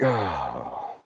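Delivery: low, soft stunned oh; dazed low oh. Retold or imitated. After the hammer hits his thumb, a low dazed oh. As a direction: let it drop out of you, stunned.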